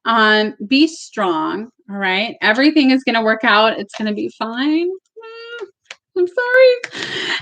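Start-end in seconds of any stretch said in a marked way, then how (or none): 4.9-7.3 high, slow tearful sorry; tearful apology. Real, not acted. Reader moved by message from client's dad, tearful. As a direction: choke up and apologize. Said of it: "I don't wanna cry".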